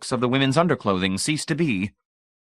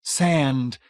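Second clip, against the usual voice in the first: high, urgent cry; 6 semitones higher, 3 dB louder than usual. A narrator's reading. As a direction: cry out the word, pitched up. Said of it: "time to cry".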